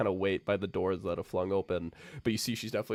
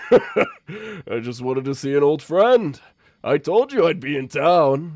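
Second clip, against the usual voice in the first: a grand beast savouring its visit; big playful villain purr, lightly voiced; smug lion voice; 6 semitones higher, 11 dB louder than usual. Lion character voiced, teasing.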